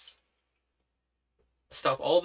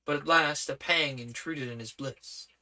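usual speaking voice

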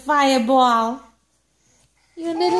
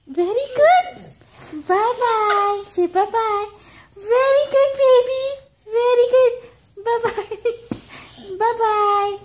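put-on high, bright praise for the baby; mother praising her baby for waving; baby talk praise; 6 semitones higher than usual. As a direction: cheer the baby on, sing-song.

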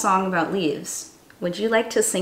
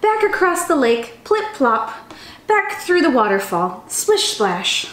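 lively, sing-song storybook reading; animated children's story voice; 4 semitones higher than usual. A narrator's reading. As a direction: read it like a chant for little kids. Reads a picture book aloud.

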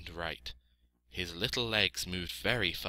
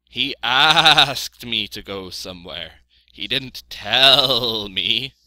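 sheep addressing the flock; 4 semitones higher, 12 dB louder than usual. A narrator's reading. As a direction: make a loud announcement as a sheep.

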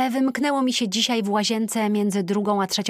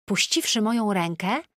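plain reading voice

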